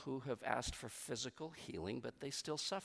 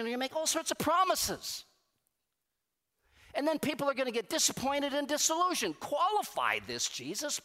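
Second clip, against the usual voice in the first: much higher and louder, insistent pleading; exasperated protest; 9 semitones higher, 4 dB louder than usual. Retold or imitated. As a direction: push back at Jesus, please, come on. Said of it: "That goes on in my brain".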